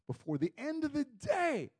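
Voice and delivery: high-pitched incredulous echo; nervous repeat. Acted. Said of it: "and I'm nervous".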